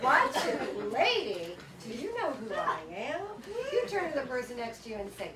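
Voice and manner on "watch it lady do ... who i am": indignant retort. Retold or imitated. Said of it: "I'm like".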